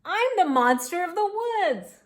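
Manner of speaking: high, loud proud announcement; little monster. A narrator's reading. Tiny monster announces itself.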